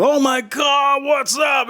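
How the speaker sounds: high, drawn-out mock shout of delight; imitating a thrilled friend on the phone; excited greeting. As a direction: greet a long-lost friend, pitch way up. Friends' delighted reaction to his call.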